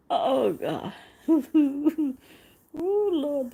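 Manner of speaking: high breathless laughing exclamation. Real, not acted. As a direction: laugh through the word Lord. Laughing fit.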